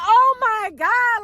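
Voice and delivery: high, slow, drawn-out groan of dismay; dismayed oh my God. Retold or imitated. Driver hits the curb on the test, slow dismayed groan. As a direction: stretch it out in dismay. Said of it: "I'm like".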